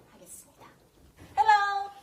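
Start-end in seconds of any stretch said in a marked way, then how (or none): 1.4-1.9 high, loud, beaming hello; cheerful hello. Real, not acted. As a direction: greet the guest with a big bright hello. Host greets guest on camera.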